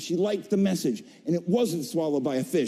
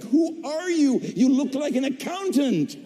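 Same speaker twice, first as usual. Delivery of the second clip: surprised director; 8 semitones higher than usual. Retold or imitated. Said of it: "And she's like".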